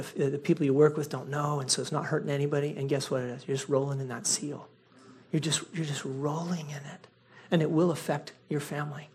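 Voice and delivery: hushed, low sober delivery; solemn warning. Real, not acted. Pastor warns church that hidden sin affects family, solemn warning. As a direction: drop right down, soft and grave.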